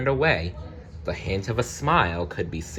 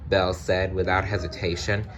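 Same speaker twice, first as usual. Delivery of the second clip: usual speaking voice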